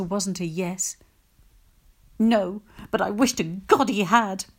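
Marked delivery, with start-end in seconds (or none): 2.2-4.5 louder angry outburst; exploding. Acted. Upset mother bursting out about her husband, angry. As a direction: burst out bitterly. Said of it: "Mum exploded".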